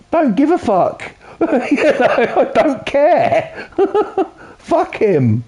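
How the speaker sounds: high, giggling dismissal; laughing it off. Real, not acted. Streamer to chat, laughing off the bully's father. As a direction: laugh through it, not caring.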